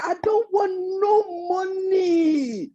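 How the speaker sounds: high, slow sly hyena voice. Acted. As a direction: draw it out like a crafty hyena. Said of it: "He said".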